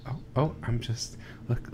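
ordinary talk, nothing marked

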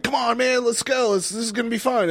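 high-pitched, hyped-up pleading; imitating an eager party cheerleader; energetic coaxing. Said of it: "I would have a bunch of energy".

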